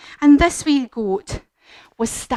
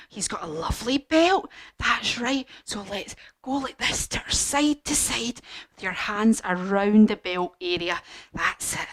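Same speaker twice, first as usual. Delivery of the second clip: ordinary delivery, nothing marked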